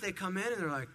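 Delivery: ordinary talk, nothing marked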